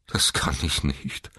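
despairing, mildly groaned protest; groaning. A narrator's reading. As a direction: moan it helplessly. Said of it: "stöhnte er". (verzweifelt stöhnend)

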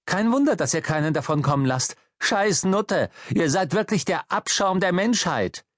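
high-pitched, bitter outburst; worked-up, contemptuous rant; angry abuse. Acted. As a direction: spit out insults in outrage. Abusing the traffic warden.

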